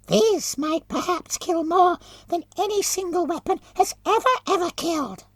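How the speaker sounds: very high, whining voice through a mask; whining muffled voice. Acted. Companion boasts of the weapon.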